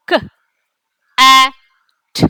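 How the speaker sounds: K, A, T each said apart, with pauses; sounding out letters. Shown as a demonstration. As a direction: say K, A, T one letter at a time. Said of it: "Let's sound it out".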